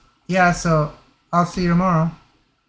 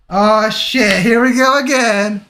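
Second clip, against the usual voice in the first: raised, louder exasperated groan of words; dismayed outburst; 5 semitones higher, 4 dB louder, slower than usual. Real, not acted. Player reacting to Sayori turning up, dismayed. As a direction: react with mock dread as trouble returns. Sayori interrupts the close moment.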